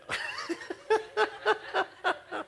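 high, drawn-out cackle from the back; a long, high cackling laugh. Real, not acted. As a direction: listener cackling behind the preacher. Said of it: "cackling behind there".

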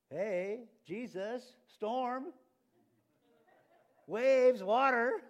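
high, loud one-word outbursts; frantic alarm. Acted. Disciples waking Jesus about the storm, frantic. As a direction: call out each word in mock panic.

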